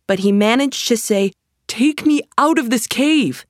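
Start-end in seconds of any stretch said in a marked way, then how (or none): 1.6-3.4 high-pitched frightened plea; a touch of fear in a raised voice; scared. A narrator's reading. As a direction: plead to escape, pitched up high. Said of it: "Aladdin was scared".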